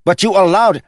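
high, loud accusation, cut off; protesting guard. A narrator's reading. Guard arguing with the prince's brother, high loud protest. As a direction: press the accusation urgently.

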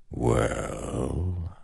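slow, soft and hollow; slow ancient shadow voice. Acted. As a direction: speak like a patient shadow god. The shadow king speaks.

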